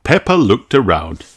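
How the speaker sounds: usual speaking voice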